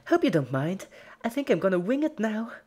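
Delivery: high, bright, bouncy cartoon pony voice; gleeful Pinky voice. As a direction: play it as a cheerful sadist. Pinky voiced gleefully before laughing.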